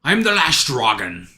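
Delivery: slurred Scottish accent impression; Sean Connery. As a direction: do a hammy Scottish movie dragon. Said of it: "I kinda went Sean Connery".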